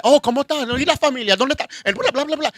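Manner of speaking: high, rapid, friendly Spanish chatter; chatty Spanish speaker. Retold or imitated. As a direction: gush in Spanish like a relative. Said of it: "the person would be like".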